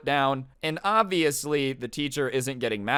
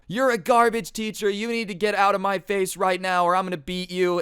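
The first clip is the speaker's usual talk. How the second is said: imitating an enraged dad, high-pitched threat; angry dad; 7 semitones higher, 4 dB louder than usual. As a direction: start high and loud, threaten the teacher. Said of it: "pure hatred and anger in his eyes".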